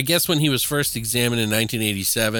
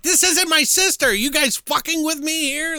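much higher and louder, fed-up outburst; mock the sister losing her patience; loud, high this isn't my sister; exasperated sister; 3 dB louder than usual. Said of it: "She's like".

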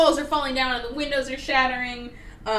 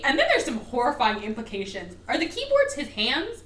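normal talking voice

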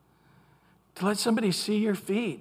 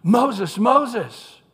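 loud, urgent calling out, pitch lifted; 5 semitones higher, 7 dB louder than usual. Acted. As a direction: call out to stop someone in their tracks. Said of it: "it's a sense of urgency".

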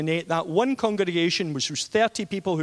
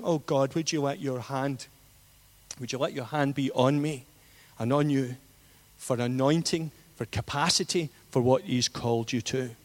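prayer; 4 semitones lower, 4 dB quieter than usual. Acted. Brief prayer over the congregation.

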